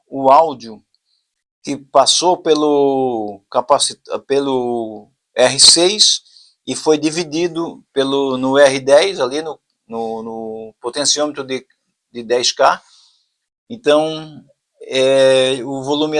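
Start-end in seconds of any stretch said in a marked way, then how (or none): none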